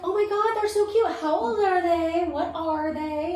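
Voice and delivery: high, loud cooing over puppies; imitated delighted fawning; gushing excitement. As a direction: gush with drawn-out questions at the end. Friends gushing over cute puppies.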